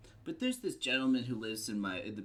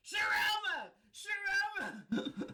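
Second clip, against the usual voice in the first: high-pitched scream across an alley; screamed name; much slower than usual. Retold or imitated. Roommate yelling up from the alley to wake Sharona, screaming. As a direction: imitate a roommate screaming for someone outside. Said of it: "like, screaming".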